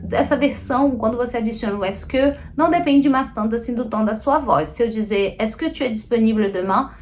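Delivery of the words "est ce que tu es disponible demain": even question, no lift on "demain"; entonação sem subir. Shown as a demonstration. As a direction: ask with "Est-ce que", keeping "demain" down. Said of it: "eu não preciso subir a entonação da voz". (pergunta neutra, sem subir a voz em "demain")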